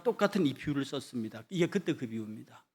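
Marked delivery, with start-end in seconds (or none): none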